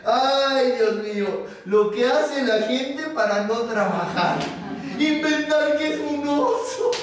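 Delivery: talking through acted laughter, rising high; laughing mockery. Foreman mocking the bear's excuse, laughing. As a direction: scoff merrily, breaking into laughs. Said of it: "empezó a reírse como loco".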